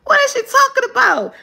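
very high-pitched, mock-confused outburst; acted bafflement. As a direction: act totally lost by the clue. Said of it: "I'd be like".

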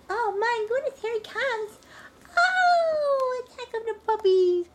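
high, loud delighted squeal; excited squeal. Real, not acted. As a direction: squeal as the puppies pile on. Puppies rush at her.

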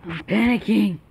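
loud cry of panic; sudden loud, frightened outburst; panicked shout. Real, not acted. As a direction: shout it out in alarm. Scared player bursting out in panic.

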